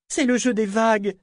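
high-pitched attempt to persuade; pleading. A narrator's reading. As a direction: reason with him as you draw him away. Gently urging him away. (voix aiguë qui tente de convaincre)

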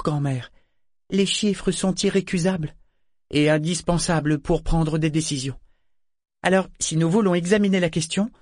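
put-on high-pitched girl reasoning with grandmother; young Malta, persuasive. A narrator's reading. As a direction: play the daughter making her case, pitched well up.

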